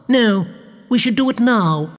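high-pitched, insistent baroness; interrupting. A narrator's reading. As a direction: cut in on your husband. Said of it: "she interrupted".

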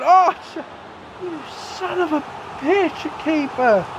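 high cursing cry; frustrated curse. Real, not acted. Gamer cursing the goalkeeper's save, high angry cry. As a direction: swear at the keeper's save. Keeper saves the shot.

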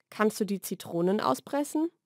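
ordinary talk

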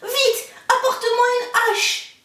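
put-on boy begging his mother for an axe; urgent boy. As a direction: demand the axe in panic. Jacques urgently calls for axe.

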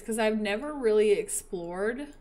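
normal talking voice

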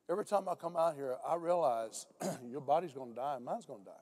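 ordinary talk, nothing marked

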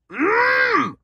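a sudden happy hum at the taste; squeaky yum sound; delighted mm. Real, not acted. Tasting the vape.

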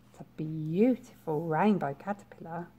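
plain reading voice

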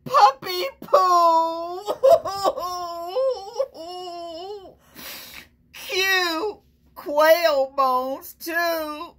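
high, squealing laugh through the words; disgusted laughing. Real, not acted. Teacher reading gross item to kids, laughing in disgust. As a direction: laugh in disgust as you force it out. I can't even say it.

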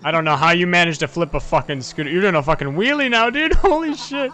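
high, loud, laughing disbelief; cracking up at a wild stunt; excited laughing. Real, not acted. Friend flips scooter, wheelie.

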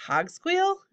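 high, rising query of recognition; surprised. A narrator's reading. Jared spotting the hobgoblin on his roof, surprised. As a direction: pitch leaps up in surprise.

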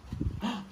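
a sharp unvoiced gasp of wonder; excited gasp. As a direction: gasp as if revealing a surprise. Dramatic gasp before each scientist.

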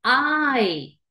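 drawn-out "ay" gliding from a to i; long I sound. Shown as a demonstration. Teacher naming the long sound, stretched "ay". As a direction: stretch "ay" slowly, falling at the end. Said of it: "Sonido largo".

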